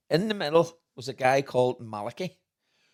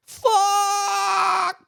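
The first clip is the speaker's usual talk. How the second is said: high, loud shout while falling; falling scream; 8 dB louder than usual. Retold or imitated. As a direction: yell as you drop off the chair. Friend falling off chairlift.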